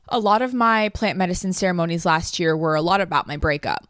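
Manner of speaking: ordinary talk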